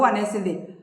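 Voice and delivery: plain reading voice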